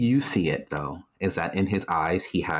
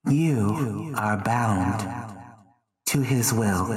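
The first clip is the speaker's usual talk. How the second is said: ominous; much slower than usual. Acted. GM pronounces the mind control.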